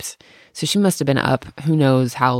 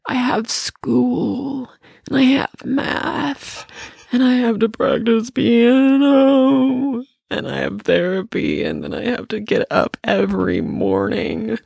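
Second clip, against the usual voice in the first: high, slow whiny sob, lightly mocked; imitating a sobbing kid; 9 semitones higher, slower than usual. Mother imitating her sobbing daughter, high slow whine. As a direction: drag it out like a crying child. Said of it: "she starts to sob".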